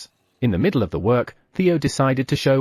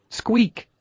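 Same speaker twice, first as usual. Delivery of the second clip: high sharp rabbit squeak; excited squeak; 11 semitones higher than usual. Acted. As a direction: squeak out the big news. Husband rabbit announces birth.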